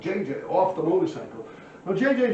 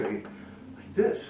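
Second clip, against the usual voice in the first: usual speaking voice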